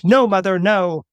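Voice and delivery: mock-heroic boy; gallant teen protest. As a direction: protest grandly to your mother. Son gallantly refuses mother's help.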